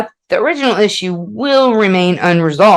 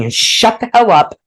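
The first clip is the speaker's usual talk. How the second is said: ordinary delivery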